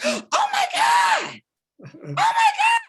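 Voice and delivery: very high, loud, drawn-out shriek; ecstatic scream. Retold or imitated. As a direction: re-enact screaming in stunned joy. Re-enacting shock at Oprah's call.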